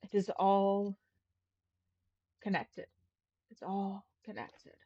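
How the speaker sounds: understated wonder, pausing between phrases; far-away awe. Acted. Game master voicing her realisation that it's all connected, in far-away awe. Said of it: "like, awe in her voice".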